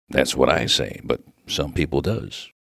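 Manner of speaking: low, emphatic rustic reply; agreeing miner. Acted. Miner agreeing with the baffled minister, low and emphatic. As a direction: agree firmly, dropping your pitch.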